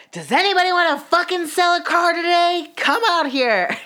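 loud, high angry holler; retold enraged bellow, pitch way up; furious shout. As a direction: yell across the office, demanding service now. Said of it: "I was so mad".

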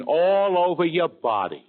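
high, drawn-out warning; slow, hammed-up grave news; exaggerated alarm. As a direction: stretch it out to frighten the patient. Exaggerates injuries to scare Finnegan.

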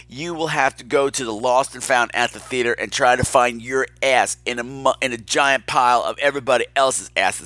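ordinary talk

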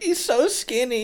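high, slow, sing-song mockery; mocking echo. Friends mocking a skinny buddy, high slow teasing. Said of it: "me and Ruth would be like".

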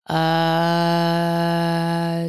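long steady "uh" on one note; sustained toning. Retold or imitated. Woman showing how her toning began, held open note. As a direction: hold an open monotone vowel. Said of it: "went from".

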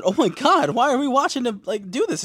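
high, loud, exasperated outcry; appalled protest. Retold or imitated. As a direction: wail in mock horror at what you saw. Repeats appalled reaction to scene.

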